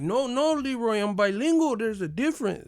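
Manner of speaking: high, prim correcting voice; prissy mock teacher. Teacher correcting Leroy, mocked in a high voice.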